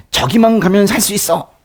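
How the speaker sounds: high, urgent hopeful shout; eager cry. Pretend. Someone running toward safety, calling out eagerly. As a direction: cry out like you just spotted safety. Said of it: "라고 생각하면 거기로 빨리 뛰어가는 거".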